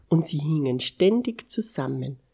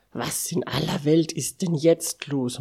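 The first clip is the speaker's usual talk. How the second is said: ordinary delivery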